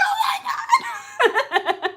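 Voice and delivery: very high, slow stretched-out squeal; mock fangirl squeal. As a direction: shriek with delight, laughing. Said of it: "I'm gonna be like".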